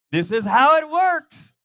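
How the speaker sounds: high, loud and slow, every word weighted; emphatic declaration. Acted. Preacher to congregation, loud slow declaration. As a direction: proclaim it like a big reveal.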